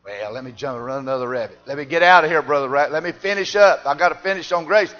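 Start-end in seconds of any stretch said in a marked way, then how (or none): 1.7-4.9 louder, slow, driving preacher cadence; rising preaching. Acted. Preacher turning back to his grace text, climbing louder. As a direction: build it back up to the pulpit roar.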